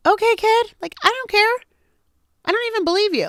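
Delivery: high, loud, mock brush-off; dismissive mother. Mother answers her child's outburst, high and dismissive.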